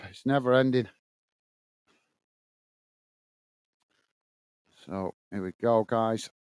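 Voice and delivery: quiet, low and winded; out of breath. Real, not acted. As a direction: still panting, words coming out tired. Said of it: "oh, catching my breath".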